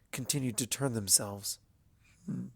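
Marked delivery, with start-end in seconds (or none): none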